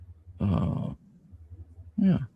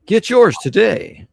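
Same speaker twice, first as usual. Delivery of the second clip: ad announcer voice; 10 semitones higher, 9 dB louder, slower than usual. Pretend. Mock ad tagline after reading ads.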